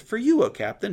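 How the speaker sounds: single him out with a lifted call; insistent high call. Acted. Messenger insists to Jehu among the captains, high and emphatic.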